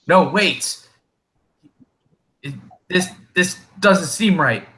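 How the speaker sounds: halting, suspicious, voiced up high; third kobold. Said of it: "a third voice say".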